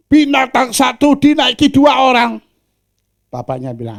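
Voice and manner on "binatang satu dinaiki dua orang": loud, high scolding; high, loud outraged onlooker; indignant. Acted. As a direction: scold the riders for cruelty. Bystander scolding rider.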